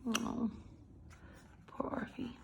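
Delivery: soft whispered sympathy. Real, not acted. Reader pitying the rejected dog in a whisper. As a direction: breathe it, full of pity. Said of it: "Look how distraught he is".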